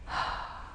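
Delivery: long soft exhale; releasing sigh. Shown as a demonstration. Guide showing how to let go on the breath out, soft sigh.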